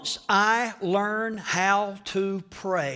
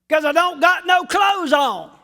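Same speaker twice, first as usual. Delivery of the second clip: loud high shouted answer; Adam's answer; 8 semitones higher, 8 dB louder than usual. Acted. Preacher voicing Adam's comic reply, shouting. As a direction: shout the obvious back. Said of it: "Adam's comic answer".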